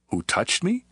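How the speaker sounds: high, rising question; incredulous echo. A narrator's reading. Disciples to Jesus in the throng, incredulous rising question. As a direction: echo it back, lifting sharply at the end.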